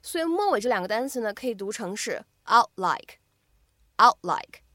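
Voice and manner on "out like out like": "out like" with the t held, unreleased; "out like" run together, t barely sounded; incomplete plosion. Shown as a demonstration. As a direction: stop the t of "out" straight into "like". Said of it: "做一个不完全爆破的处理".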